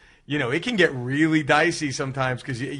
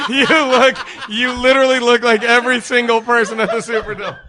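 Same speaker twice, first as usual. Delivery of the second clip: loud, pitched up, laughing while teasing; laughing loud tease; 9 semitones higher, 10 dB louder than usual. Real, not acted. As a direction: roast him through your laughter.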